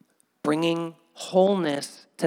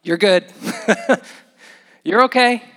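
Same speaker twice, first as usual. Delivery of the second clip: much louder and higher, laughing; bright reassurance; 6 semitones higher, 9 dB louder than usual. Retold or imitated. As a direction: cheer him on with a laugh. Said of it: "He just said".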